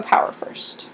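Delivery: playful stage whisper. Real, not acted. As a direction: whisper it like a secret. Teacher drops into a playful aside.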